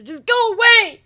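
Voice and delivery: high, loud shout to go away; exasperated holler at the video; yelling. Real, not acted. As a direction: shoo them off the screen. Yelling at guys on screen.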